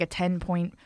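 usual speaking voice

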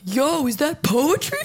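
slowly drawn-out, incredulous question; lightly voiced hobo, puzzled by poetry; imitated hobo. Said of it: "hobo guys is like".

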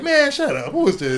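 high, loud retold scoff; incredulous dismissal. Artist refusing to believe the famous caller, incredulous.

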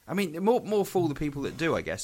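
normal talking voice

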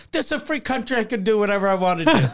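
high, fast mock of an entitled shopkeeper; mocking defiant woman. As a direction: imitate her snotty defiance, rushed. Woman defying cops, quoted mockingly.

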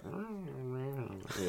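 quiet, wordless mock sulking hum; sulky bird grumble. Owner imitates the magpie sulking in the corner, quiet grumble. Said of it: "she puts her head down".

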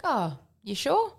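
fake-polite stall on an offered favour; mock polite doubt. To a couple offering to hang the washing, mock polite doubt. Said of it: "And I was like".

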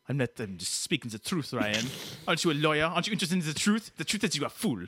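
put-on scornful French-Canadian accent; indignant French-Canadian lecturing; Quebecois Dave. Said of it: "let Quebecois Dave do it here".

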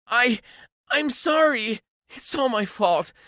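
high-pitched, pleading apology; pleading girlfriend. Acted. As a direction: beg forgiveness in a high girlish voice. Ex-girlfriend begs him back.

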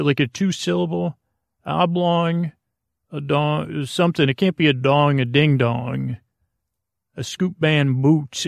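normal talking voice

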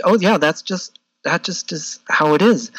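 ordinary talk

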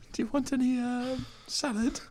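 timid server voice. Acted. Server offering salad to the mafia boss, high and soft. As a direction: offer salad in a meek falsetto. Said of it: "Subway server".

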